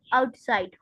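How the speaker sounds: ordinary delivery, nothing marked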